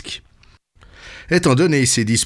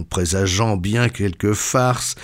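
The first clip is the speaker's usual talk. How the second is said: ordinary delivery, nothing marked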